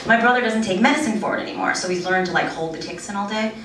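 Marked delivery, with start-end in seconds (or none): none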